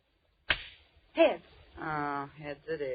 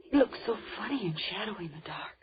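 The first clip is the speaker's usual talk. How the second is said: whisper; 4 dB quieter than usual. Acted. Whispering in the dark house.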